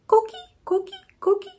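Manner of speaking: high chirping frog call coqui; high two-note coqui frog chirp. Shown as a demonstration. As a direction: chirp it like a tiny tree frog. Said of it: "make a sound that sounds like their name".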